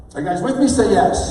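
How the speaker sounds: punchy call-and-response demo; rallying tie-down. Shown as a demonstration. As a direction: get the room shouting back. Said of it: "That's how you do it".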